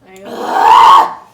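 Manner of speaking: strained shout. Real, not acted. Spicy challenge, pained outburst.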